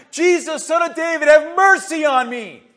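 loud, high plea building to a shout; full-voiced, insistent begging shout; desperate cry out. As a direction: cry out for mercy over the crowd. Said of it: "he cried even louder".

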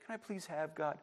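very soft, meek little request; hushed, tentative prayer; mild polite asking. Acted. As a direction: ask timidly, almost under your breath. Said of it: "You're not just saying".

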